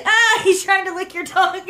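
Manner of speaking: laughing through the words; giggling while talking. Real, not acted. Baby licking screen, mom laughing.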